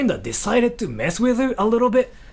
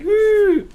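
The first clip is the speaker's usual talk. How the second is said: teasing ooh; 13 semitones higher, 6 dB louder than usual. Retold or imitated. Teasing sound while ruining drawing.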